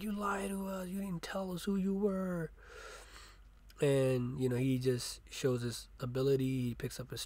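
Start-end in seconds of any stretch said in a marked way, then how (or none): 0.0-2.5 betrayed family. Retold or imitated.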